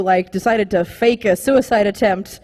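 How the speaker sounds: ordinary talk, nothing marked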